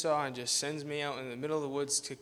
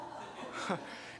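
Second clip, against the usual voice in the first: breathy laugh; 7 semitones higher, 9 dB quieter than usual. Real, not acted. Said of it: "And you all know that feeling".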